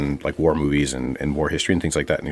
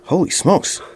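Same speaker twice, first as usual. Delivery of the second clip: a hint of a startled patron; shocked bar guy; 9 semitones higher than usual. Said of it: "The guy goes".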